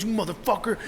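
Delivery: high, strained road-rage curse; mock angry dad.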